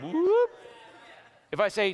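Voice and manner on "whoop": high rising whoop; bright upward electronic "whoop"; imitated Alexa chime. Preacher mimics Alexa waking up, high rising whoop. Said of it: "she gets a little circle on top".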